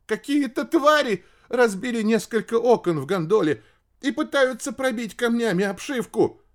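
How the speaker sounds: very high, alarmed report; trembling, frightened. A narrator's reading. Ship's radio operator under attack, frightened. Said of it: "дрожащим голосом ответил радист".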